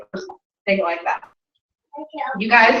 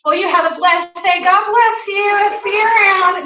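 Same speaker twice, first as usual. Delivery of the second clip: higher, sweetly slow God bless you; sugary blessing; 6 semitones higher, slower than usual. Retold or imitated. Answering a rude customer with a sugary blessing. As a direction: kill them with kindness. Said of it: "picked it up out of the trash and said".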